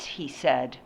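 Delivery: usual speaking voice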